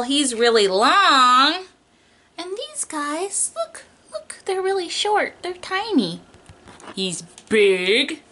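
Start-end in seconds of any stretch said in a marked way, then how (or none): 2.4-6.2 high and soft; tiny voice. Acted. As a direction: go small and squeaky for tiny. Describing tiny dinosaurs for opposites.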